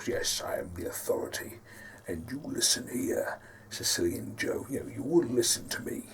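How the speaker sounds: low, hushed, breath-heavy authority; Carl Magnus. Shown as a demonstration. Authority figure ordering Sicilian Joe to listen, hushed and commanding. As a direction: speak softly and deep, demanding to be heard. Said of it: "a bit more deep, a lot more authoritative".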